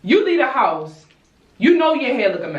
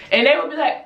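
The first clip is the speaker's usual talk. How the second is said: ordinary talk, nothing marked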